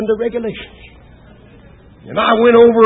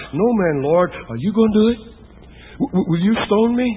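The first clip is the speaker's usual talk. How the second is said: softer, halting question; frightened woman asks Jesus if he will stone her, pleading; 4 dB quieter than usual. Acted. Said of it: "more of a question than a statement".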